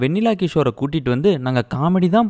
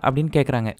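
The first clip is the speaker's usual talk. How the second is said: usual speaking voice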